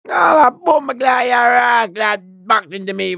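loud, high, slowing Jamaican-tinged challenge; aggressive shout. Retold or imitated. Inmate confronts him in the yard, shouting. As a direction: bark the challenge like a prison bully. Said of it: "And he said".

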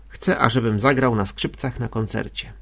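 plain reading voice

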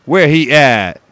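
playful put-on street voice. Shown as a demonstration. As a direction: ham up a street-tough voice.

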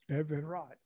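Everard in three syllables, eh-veh-rod; proper pronunciation. Shown as a demonstration. Man repeats his right name for the room, Everard as eh-veh-rod. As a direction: give "Everard" its full middle vowel, eh-veh-rod. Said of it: "is my right name".

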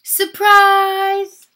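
high, very loud party shout; joyful shout. A narrator's reading. All the ladies greet the guest of honour, shouting. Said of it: "all the ladies shouted".

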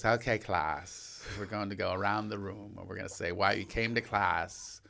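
a hint of a hushed earnest coach; imitating acting teacher. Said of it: "He goes".

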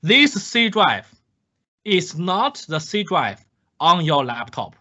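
stern teacher stressing "this C drive is not the C drive on your laptop"; emphatic warning. Shown as a demonstration. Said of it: "let me emphasize for multiple times".

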